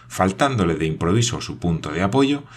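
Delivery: ordinary delivery, nothing marked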